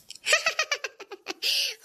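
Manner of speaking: light, high-pitched laugh; high giggling laugh. Acted. Friend laughing at her exasperated friends, high giggle. Friends teasing before going out.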